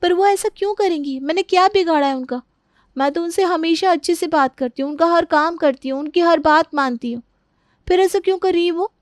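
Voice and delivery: troubled daughter-in-law. Acted. Daughter-in-law wondering why she is being faulted, troubled. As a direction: wonder aloud as a wronged young wife.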